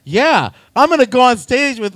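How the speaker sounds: mock enthusiasm to shoot down an idea; acted sarcasm. Sarcastic reply to suggestion.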